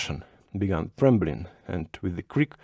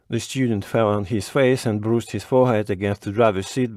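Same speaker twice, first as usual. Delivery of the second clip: ordinary delivery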